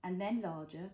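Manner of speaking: slow, gentle picture book reading; reading to a toddler. A narrator's reading. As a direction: read it slowly for a little one. Reads picture book to child.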